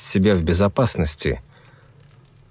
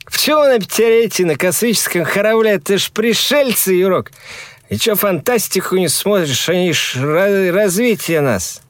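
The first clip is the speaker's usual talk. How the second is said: put-on drunk young man arguing; 10 semitones higher than usual. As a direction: argue drunkenly, pitched up. Drunk friends arguing on bridge.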